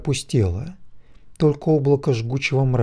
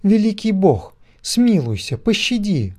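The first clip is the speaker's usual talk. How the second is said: crying out for mercy; 8 semitones higher than usual. A narrator's reading. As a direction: beg for mercy at full voice. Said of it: "кричала она". (крик о пощаде)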